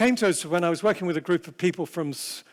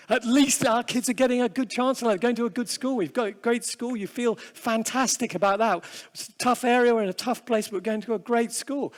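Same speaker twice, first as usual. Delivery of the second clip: a hint of proud parents, pitched higher; 5 semitones higher than usual. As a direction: lightly voice the parents boasting about their school. Voicing proud parents of pupils.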